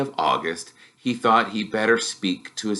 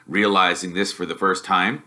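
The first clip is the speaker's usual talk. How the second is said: ordinary delivery, nothing marked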